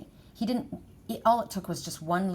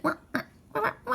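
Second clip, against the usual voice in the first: high-pitched imitated cat wail; crying cat; much faster than usual. Upset cat answering its owner, high mock crying. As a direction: mimic a little cat crying. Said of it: "he listens to me, and he's like".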